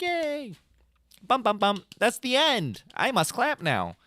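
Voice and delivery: high, bright mock cheer; mock crowd. Mimicking the crowd knowing when to clap, high mock. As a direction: play the relieved audience. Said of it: "they can be like".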